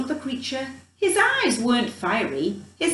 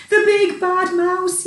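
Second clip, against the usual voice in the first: higher, louder frightened yell; 7 semitones higher, 5 dB louder than usual. A narrator's reading. Child yelling at the mouse's shadow, frightened. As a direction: cry out in terror. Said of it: "yelled the Gruffalo's child".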